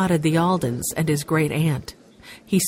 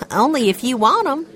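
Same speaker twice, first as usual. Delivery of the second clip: a hint of a grinning waitress, higher; lightly voiced, amused waitress; 7 semitones higher, 3 dB louder than usual. A narrator's reading. Waitress teasing Benny about peas, grinning.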